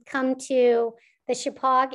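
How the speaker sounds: usual speaking voice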